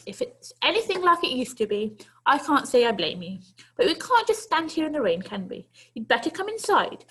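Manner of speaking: put-on a brisk granny inviting the boy in; kindly old lady. Old lady to the runaway boy, kindly coaxing.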